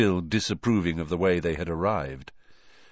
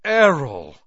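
startled cry; 5 semitones higher, 8 dB louder than usual. Acted. Owl crashes into the jug.

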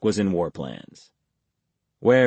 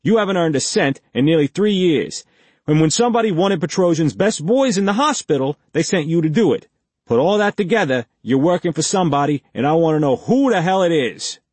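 higher, louder pushy cop voice; gruff interrogator; 8 semitones higher, 5 dB louder, faster than usual. A narrator's reading. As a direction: press him like a hostile detective. Blake interrogates Reacher.

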